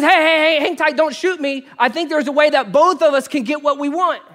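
high, loud urgent bear voice; pleading bear. Acted. Talking bear pleads with hunter.

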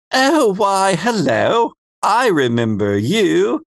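swooping, drawn-out character voice; Phoebe. Acted. Phoebe greets one of Baz's friends in her new voice. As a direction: stretch the greeting with big pitch swings. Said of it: "This is my new Phoebe voice".

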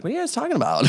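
high-pitched clueless question; lightly voiced dad wandering in curious; oblivious dad. Retold oblivious dad walking in.

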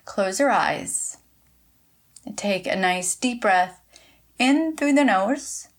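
unhurried instructions with long pauses; measured meditation voice, leaving room to breathe; guided meditation. Acted. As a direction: guide listeners gently into relaxation, phrase by phrase. Leads listeners in short meditation.

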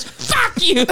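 a high, laughing fuck you; impersonating the man cursing at the news lady; high mock curse. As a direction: squeak the curse at the reporter. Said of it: "He goes".

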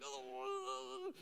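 long high-pitched mock wail; imitated wail of pain. Child crashed her bike, imitated high wail of pain. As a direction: cry out like a hurt child, acted. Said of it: "and she's in pain".

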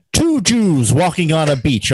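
loud, high, drawn-out comic launch; Gilbert Gottfried impression. Storyteller mimics comedian launching straight into jokes, loud high impression. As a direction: belt out the joke opener, high and slow. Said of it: "He goes, immediately into it".

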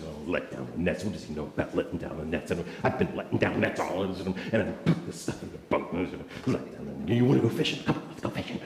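low, resentful muttering; grumbling under breath. Acted. Simon grumbles while packing his boat, muttering resentfully. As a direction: mutter crossly to yourself, barely voiced. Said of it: "that's the attitude that Simon had".

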